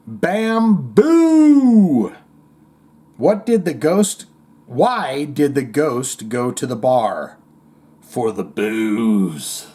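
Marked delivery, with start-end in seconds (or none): 0.1-2.1 high, loud, drawn-out ghostly boo; spooky ghost punchline. Acted. Host hamming up a ghost panda joke's punchline, spooky boo. 8.0-9.7 low, long-drawn booze; ghostly punchline. Acted. Host delivering a ghost bar joke's punchline, low ghostly drawl.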